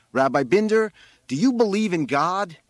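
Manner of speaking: high, loud questioning; calling out a demand. A narrator's reading. Boy on the roof testing the rabbi, loud demand. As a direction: call the question down loudly.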